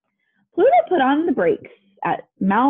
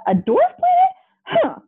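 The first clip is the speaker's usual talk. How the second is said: very high, squealed outrage; hammed-up high-pitched protest; indignant yell. A narrator's reading. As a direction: yelp it in disbelief. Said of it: "he yelled".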